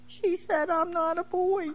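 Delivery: high, lightly voiced sad little boy; mock crying little boy. Teacher imitating a crying boy, mock-sad and high. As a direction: play a small boy near tears, lightly. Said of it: "I see him cry".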